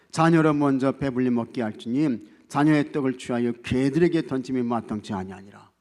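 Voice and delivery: loud, declaimed Bible verse; scripture reading. Real, not acted. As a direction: read the verse out forcefully to the congregation. Reads the Bible verse aloud.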